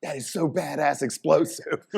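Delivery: slow, high, awestruck praise; amazed. Retold or imitated. Marveling to the audience at the dog's speed. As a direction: savour each word in disbelief. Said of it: "But I was like".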